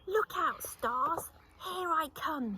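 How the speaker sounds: loud, high, triumphant yell; shouted. Acted. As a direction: frog launching himself at the stars. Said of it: "he shouted".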